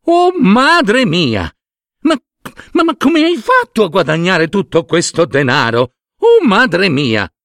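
high, amazed cry of joy; overjoyed wife. A narrator's reading. Wife sees the bag of silver, high excited exclaiming. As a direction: exclaim high in joyful disbelief. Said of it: "saltò con gioia".

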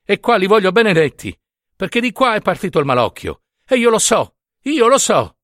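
high, vehement insistence; insistent pleading. A narrator's reading. Nunziata demands the blessing from her curate, imploring. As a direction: plead with rising pitch and conviction. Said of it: "supplicò Nunziata".

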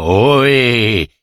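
drawn-out call in a put-on voice; mock holler of an old man in disguise; disguised voice calling out. A narrator's reading. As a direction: call out as someone else, voice changed. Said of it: "mutando la voce gridò".

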